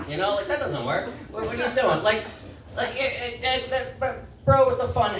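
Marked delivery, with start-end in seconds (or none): none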